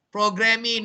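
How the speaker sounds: programming said as "program-ee", ending in mee; mispronounced final E. Shown as a demonstration. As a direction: add a wrong ee to the end of programming. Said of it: "not programming".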